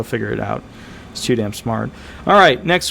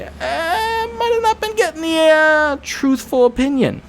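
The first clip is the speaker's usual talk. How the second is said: hammed-up crying voice, much higher; playful mock-crying whine; mock whimper; 14 semitones higher than usual. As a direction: fake a whiny sob. Mock whimper about dishonest claimants.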